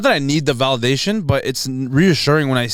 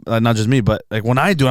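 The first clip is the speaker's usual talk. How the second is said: ordinary talk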